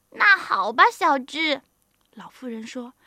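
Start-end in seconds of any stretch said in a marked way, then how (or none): 0.1-1.6 put-on old mother relenting. Mother relents after son's promise.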